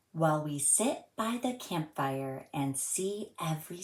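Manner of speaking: gentle sing-song storybook reading; reading to children. A narrator's reading. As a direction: read it like a rhyme for little kids. Reads a rhyming picture book aloud.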